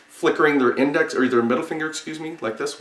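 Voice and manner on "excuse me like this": normal talking voice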